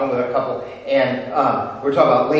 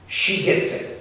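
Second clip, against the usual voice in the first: hushed realization; 4 semitones higher, 4 dB quieter than usual. Acted.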